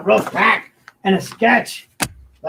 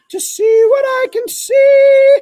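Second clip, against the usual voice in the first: high wail; 4 dB louder than usual. Acted. Continues the high wailing bit.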